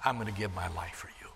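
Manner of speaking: whisper. Acted. Jesus promising his life.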